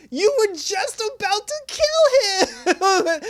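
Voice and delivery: very high, loud, drawn-out protest breaking into laughter; incredulous outburst. Acted. Viewer talks back to dying villain's apology, incredulous outburst. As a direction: squeal it slowly in laughing disbelief.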